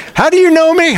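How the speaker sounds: high, squeaky indignant question; mock indignant question. A person asking how they are recognized, mock indignant. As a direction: squeak it like someone offended. Imagined exchange.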